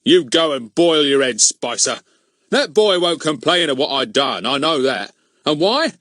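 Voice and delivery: self-righteous grumbling policeman; rude. A narrator's reading. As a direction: rudely brush off the protest. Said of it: "said Mister Goon rudely".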